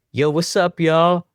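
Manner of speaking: swagger into the room calling out; rapper's greeting. Retold or imitated. [name] enters the room.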